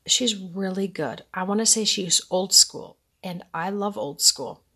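normal talking voice

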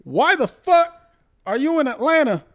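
high, loud outraged yell; furious yell. Retold or imitated. Son yelling at his dad over the phone, outraged. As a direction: let him have it, outraged.

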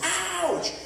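very high comic yelp; mock pain. Dad pretending the seatbelt strap hit him, mock yelp of pain. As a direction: squeal an exaggerated ouch.

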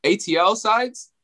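high, slow Atlanta drawl; stretched-out mock Atlanta voice; Atlanta accent. Shown as a demonstration. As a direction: put on a Southern Atlanta accent. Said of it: "But, like, I'd say South".

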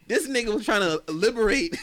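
high, loud, laughing disbelief. Real, not acted. Host comparing his own youth to the activist's, laughing. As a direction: exclaim it while breaking into laughter.

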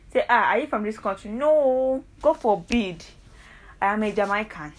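ordinary delivery

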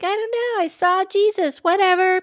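put-on high, light child voice babbling; high childish voice. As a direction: play a nine-year-old shrugging it off. Voicing a nine-year-old child.

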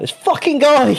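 high, annoyed "this fucking guy"; exasperated outburst. Retold or imitated. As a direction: blurt it out, fed up with him. Annoyed opponent quote.

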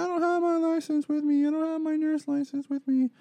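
high, loud, frantic shrieking mimic of the wife; mock her shrill panicked yelling on repeat; a woman yelling the same thing over and over; panicked yelling nurse. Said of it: "fucking yelling as loud as possible".

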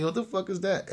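high-pitched comic disbelief; baffled retold reaction; mock bewilderment. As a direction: blurt it out high, half laughing. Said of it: "I was like".

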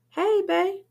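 bright, high happy hello; cheerful greeting. A narrator's reading. Carmen greeting Shakim at the door, cheerful and high. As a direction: greet him happily. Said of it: "she says cheerfully".

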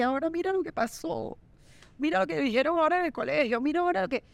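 high, fretful rush of worry; mock panic. Mother panicking over school news, high and fretful. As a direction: fret over every little thing. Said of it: "ahogar en un vaso de agua".

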